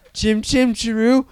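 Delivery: high, slow, silly singsong; Stephen Merchant. Acted. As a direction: lilt it out in a goofy high voice. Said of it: "Now you're Stephen Merchant".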